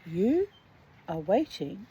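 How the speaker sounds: slow, deliberate reading aloud; story narration. Acted. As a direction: read the story slowly and evenly. Reads the short story aloud.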